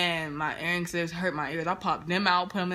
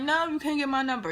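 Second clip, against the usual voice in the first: emphatic no; 8 semitones higher, 4 dB louder than usual. Retold or imitated. Refusing the dude.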